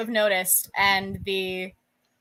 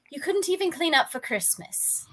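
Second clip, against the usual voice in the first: lightly voiced English woman, pitched up; higher English-accented mother's voice; 8 semitones higher than usual. Woman chiding her husband over grease, English accent. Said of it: "a very thick, uh, Eng-, well, E-, English accent".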